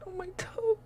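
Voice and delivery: a high crying wail over the toe; a sudden high pained cry about her stubbed toe. Real, not acted. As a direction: let the pain break through high.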